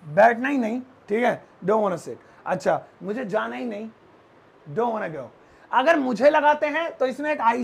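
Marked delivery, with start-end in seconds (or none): none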